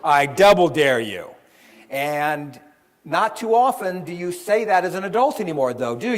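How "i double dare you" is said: loud schoolyard taunt on double; playful kid's challenge, much louder; childish dare. Retold or imitated. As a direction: call out the dare like a kid. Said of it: "what'd your best friend always say back".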